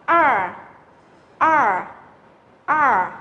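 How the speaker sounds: "R" as a drawn-out retroflex er; curled-tongue R. Shown as a demonstration. Teacher models harder vowel for students, "R" with curled tongue. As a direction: curl the tongue back and hold each "R". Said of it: "Đây là âm cuốn lưỡi".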